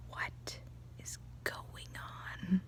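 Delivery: slow, whispered bafflement; mock-stunned whisper; hushed aside. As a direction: whisper it in stunned disbelief, imitating. Imitating husband's baffled aside.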